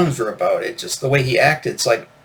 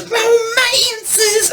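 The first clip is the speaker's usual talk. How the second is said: very high, stretched-out mock rock vocal. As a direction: squeal it high like a flamboyant singer. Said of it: "I just love when he says".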